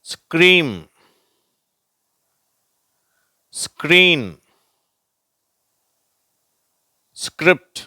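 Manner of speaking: "ஸ்க்ரீம், ஸ்க்ரீன், ஸ்கிரிப்ட்" high and loud, long pauses between; blended scr. Shown as a demonstration. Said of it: "வார்த்தைகளை பார்ப்போம்".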